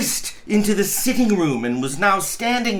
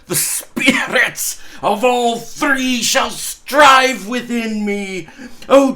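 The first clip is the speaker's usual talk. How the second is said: put-on an old miser vowing to change, higher and louder; Scrooge's fervent vow; 4 semitones higher, 4 dB louder than usual. Scrooge swearing to Marley on his knees, fervent and raised.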